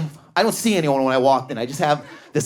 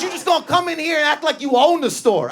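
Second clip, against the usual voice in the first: mock scolding; 10 semitones higher than usual. Shop guy scolding customer.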